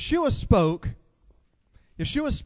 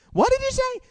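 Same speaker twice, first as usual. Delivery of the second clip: put-on very high squeaky old wife, a bit louder; deaf wife again; 3 dB louder than usual. Wife who misheard her husband, high squeaky voice.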